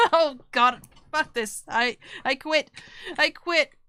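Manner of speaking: real embarrassed laughter; laughing in defeat. Real, not acted. Streamer cracking up at a failed villain voice, laughing. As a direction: laugh while giving up.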